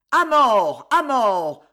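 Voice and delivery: vociferous shouting. A narrator's reading. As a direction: shout like a mob calling for a death. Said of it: "vociférèrent les spadassins". (cris de mort vociférés)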